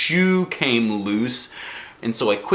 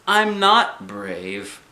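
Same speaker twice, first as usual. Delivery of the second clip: higher, very slow confession; sad admission; 10 semitones higher, much slower than usual. A narrator's reading. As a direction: admit it in a small sad voice. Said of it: "I said".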